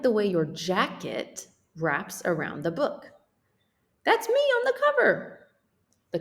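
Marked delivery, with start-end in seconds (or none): 4.0-5.5 squeaky thrilled cry; the character sees itself on the cover, squealing high; high excited voice. Acted. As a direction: show off your picture.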